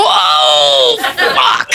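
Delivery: low, drawn-out groaning curse; envy impression. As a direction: groan at the neighbor's new car. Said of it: "That's envy".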